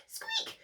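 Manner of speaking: very high, soft mouse squeak; tiny mouse squeak. Acted.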